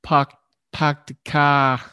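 slow, halting try at Boston speech; tentative fake Boston accent. Shown as a demonstration. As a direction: drop the r in "car", Boston style. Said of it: "I think that's how they say it".

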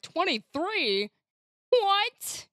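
drawn-out number, then high rising question; mock bewilderment. Player mimics her slow-counting friend, mock bewildered. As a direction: imitate someone baffled by a sum. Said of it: "and you're like".

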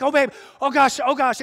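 high, loud panicked cries to his wife; yelping in fright at a moth; panicked squeal. Retold or imitated. Pastor retelling his moth phobia to the congregation, high panicked yelps. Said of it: "I have a phobia".

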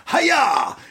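high mock martial arts yell; Dijak's hiya. Mocking Dijak's karate cry. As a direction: squeal it like a karate kick. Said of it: "Dijak just kept saying".

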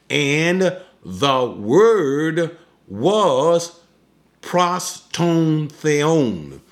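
slow careful reading. Shown as a demonstration. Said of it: "Now in the Greek it says".

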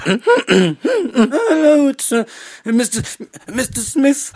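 very high, faltering, tearful squeak; hammed-up boy struggling to compose himself; tremulous impression attempt. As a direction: falter through it, squeaky and near tears. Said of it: "compose myself".

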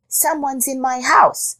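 high-pitched rabbit voice; complaining rabbit. A narrator's reading. Rabbit telling Jackal of the intruder, high complaint.

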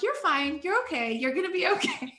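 high, sing-song soothing to an angry driver; mock reassurance. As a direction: coo it at him over a smile, laughing. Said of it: "smiling and waving".

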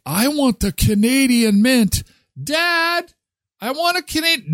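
put-on high, whiny kid voice begging; child begging dad. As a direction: plead like a little kid at the store. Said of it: "it'd be like".

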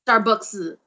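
"Starbucks" with an imitated Cantonese accent. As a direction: mimic a Cantonese husband saying "Starbucks". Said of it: "Because he doesn't say".

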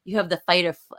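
ordinary talk

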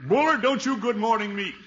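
high, loud indignant snap; angry retort. Acted. Gildersleeve snapping at his neighbor Bullard over a greeting, indignant and loud. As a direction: bristle at him before he can speak.

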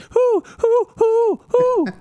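high hooting like owls; mimicking owls in the woods; owl hoots. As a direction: hoot like a bunch of owls. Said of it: "five or six owls started hooting".

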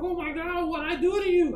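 loud, very high-pitched mock protest; imitating girlfriend. Girlfriend to her grumpy sleepless boyfriend, shrieky mock protest. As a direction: mimic a wounded partner, high and loud. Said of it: "She's like".

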